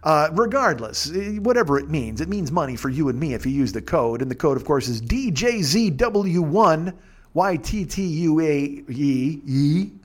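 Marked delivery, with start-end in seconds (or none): 5.0-9.9 slow, letter by letter; slow spelling. Shown as a demonstration. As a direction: spell it out carefully. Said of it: "spelling code slowly".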